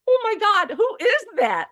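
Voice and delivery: high, loud astonished squeal; imitating a client stunned by her own photo; retelling a woman seeing her portrait, high and loud; amazed disbelief. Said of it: "she's like".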